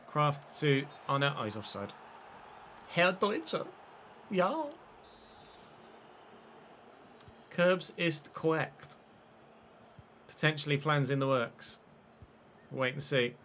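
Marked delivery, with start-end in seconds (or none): 2.9-8.9 mock German accent. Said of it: "in that accent".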